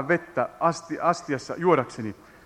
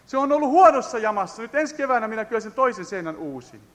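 fiery preaching; 9 semitones higher than usual. Acted. Preaching at full force. (kiihkeä saarnaääni)

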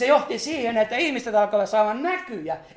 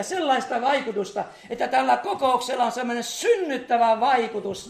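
fervent preaching; 4 semitones higher, 5 dB louder than usual. Acted. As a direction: preach it out with rising fire. Fervent prayer request, rising. (kiihkeä saarnaääni, korkeampi ja kovempi)

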